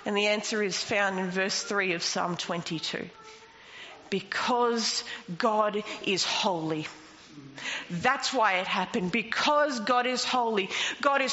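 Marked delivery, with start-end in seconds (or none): 4.1-6.8 slow emphatic. Shown as a demonstration. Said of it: "repeated for emphasis, slow take".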